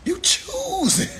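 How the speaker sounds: high, slow, laughing disbelief. Real, not acted. Comic mocks a person refusing gum, high laughing.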